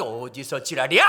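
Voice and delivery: slow, loud, menacing growl of the line; mock tough retort. Husband warning the neighbor, slow and threatening. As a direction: drag out each word to intimidate.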